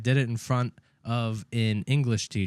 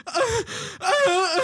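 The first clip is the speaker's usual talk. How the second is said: high mock sobbing gasps; hammed-up wordless crying; imitated bawling. Teacher bursting into tears, imitated bawling. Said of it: "she started bawling her eyes out".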